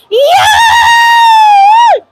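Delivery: loud, high whoop; excited shout. Acted. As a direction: cheer it out big. Shows move learned from friend.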